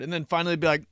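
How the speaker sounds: ordinary talk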